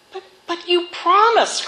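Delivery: high stammering cry of betrayal; hurt cry. Acted. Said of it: "cried the youth".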